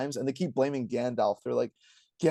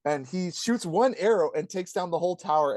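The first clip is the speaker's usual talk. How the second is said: ordinary talk, nothing marked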